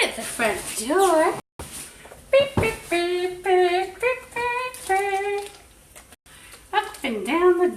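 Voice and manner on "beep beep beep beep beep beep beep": high playful beeps; beeping noises. Real, not acted. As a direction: imitate a machine beeping. Imitating the spray noise.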